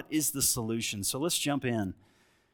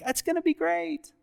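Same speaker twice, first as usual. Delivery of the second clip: naive newlywed gushing. Retold or imitated. Mocking naive newlywed excitement.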